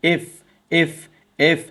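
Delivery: "এফ" repeated with spaced, careful f; lip-teeth F drill. Shown as a demonstration. Teacher leading students to repeat along, careful F pronunciation. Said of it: "যেমন উচ্চারণ করো আমার সাথে".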